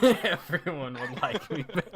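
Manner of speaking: loud, laughing repeat; laughing mock echo. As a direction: tease them by repeating it through laughter. Teasing echo through laughter.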